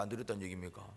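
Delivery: tossed-off second option; dropped contrast. Acted. Preacher drops his voice for the second option. Rhetorical either-or question.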